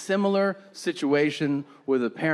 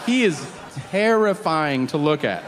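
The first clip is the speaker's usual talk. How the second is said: ordinary delivery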